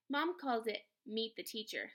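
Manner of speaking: plain reading voice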